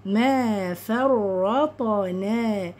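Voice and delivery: "ما فرطنا" very slow, t echoed, light final naa; bouncing ta. Shown as a demonstration. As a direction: chant "ما فرطنا" as the model. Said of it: "والطاء ساكنة تبقى مقلقلة".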